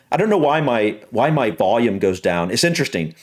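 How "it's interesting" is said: ordinary delivery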